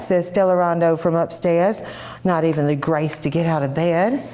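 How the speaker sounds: usual speaking voice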